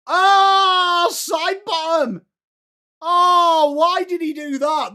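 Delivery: high, loud, drawn-out outcry; real commentator's wail of disbelief; anguished shout. Real, not acted. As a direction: cry out in dismay at the blunder. Cries out at a player's blunder.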